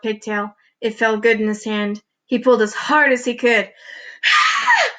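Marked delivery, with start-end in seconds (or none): 4.2-4.9 very high, loud shriek; startled girl's shriek in class; girl's scream. A narrator's reading. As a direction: let out a sudden piercing scream. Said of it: "screamed Leslie".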